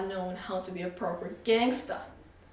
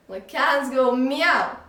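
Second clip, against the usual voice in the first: slow, louder, stressing meow; emphatic explanation; 4 semitones higher, 9 dB louder, much slower than usual. Acted. Shopkeeper slowly explains the meow clue to the boy. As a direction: spell it out slowly.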